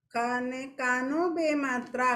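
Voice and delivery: ordinary delivery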